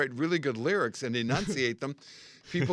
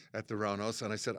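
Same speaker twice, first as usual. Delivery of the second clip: ordinary talk, nothing marked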